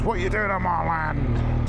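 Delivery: mock the farmer shouting at trespassers; angry farmer. Rider imitating an angry farmer confronting him on his land, mock anger. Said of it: "some angry farmer comes out and says".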